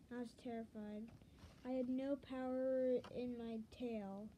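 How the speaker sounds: usual speaking voice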